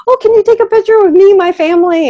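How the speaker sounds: high, loud and fast, gushing with delight; bubbly excitement. Retold or imitated. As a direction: blurt it out like an excited fan asking for a photo. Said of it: "I was all excited".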